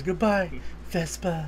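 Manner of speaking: slow, drawn-out dying farewell; overacting dying king. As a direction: ham up a slow dying goodbye. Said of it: "and he's overacting".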